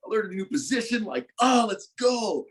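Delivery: high, bursting with joy; thrilled young player who finally got his shot; giddy excitement. Retold or imitated. Retold joy at getting to play.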